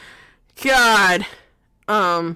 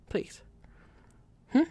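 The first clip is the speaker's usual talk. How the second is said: taunting whisper; 11 dB quieter than usual. Acted. Taunting the character, asking softly.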